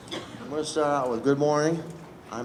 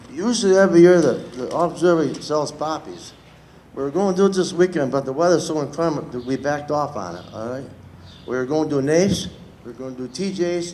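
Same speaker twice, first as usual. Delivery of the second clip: usual speaking voice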